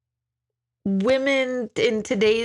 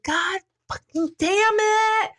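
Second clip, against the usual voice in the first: high, slow furious outburst; frazzled mom swearing; 11 semitones higher than usual. Retold or imitated. A mother at her kids over spilled bath water, high angry outburst. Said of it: "I was crazy".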